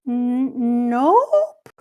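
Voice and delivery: fib badly like a little kid, voice shooting up; terrible liar. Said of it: "What a terrible liar".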